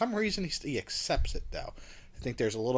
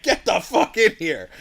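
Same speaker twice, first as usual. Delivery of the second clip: high, loud, urgent yell; acted shouted order; 8 semitones higher, 13 dB louder than usual. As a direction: recap exploding into a hollered command. Acts out car screeching up, order.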